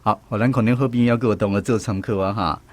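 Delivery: ordinary delivery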